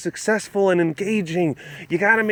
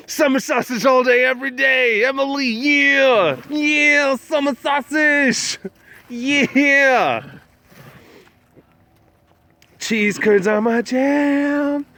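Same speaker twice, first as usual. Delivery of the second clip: higher, slow, drawn-out local bro hype; Wisconsin bro; 7 semitones higher, slower than usual. Pretend. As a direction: ham up a proud Wisconsin dude. Praising summer sausage, cheese curds.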